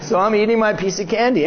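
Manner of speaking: ordinary talk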